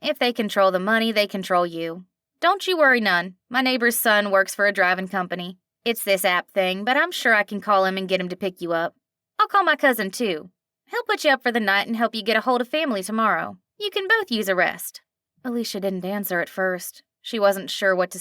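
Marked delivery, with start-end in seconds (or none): 0.0-2.1 a friendly diner waitress with a slight twang; a hint of a nasal twang. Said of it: "slight twang to it". 2.4-3.3 louder, kindly nasal twang; light nasal twang, comforting; reassuring twang. Acted. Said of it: "slight twang to it". 3.5-15.0 a friendly diner waitress with a slight twang; a hint of a nasal twang. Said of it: "slight twang to it".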